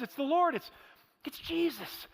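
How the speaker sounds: awed and excited cry; excited recognition. Retold or imitated. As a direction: realize it's Jesus, thrilled. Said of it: "and John goes".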